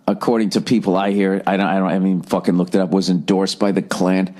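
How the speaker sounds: ordinary delivery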